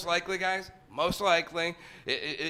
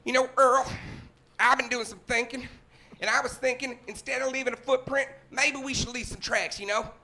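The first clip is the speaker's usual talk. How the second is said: higher-pitched backwoods character voice; lookout hoaxer; 7 semitones higher than usual. Acted. Lookout in the woods pitches Earl an idea, higher character voice. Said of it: "I'll start as the guy keeping watch".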